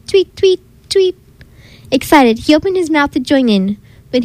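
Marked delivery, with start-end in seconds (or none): 0.1-1.2 high chirpy tweets; birdsong. A narrator's reading. Birds waking the bunny, high chirps. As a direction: chirp like a morning bird. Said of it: "birds singing".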